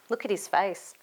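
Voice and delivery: plain reading voice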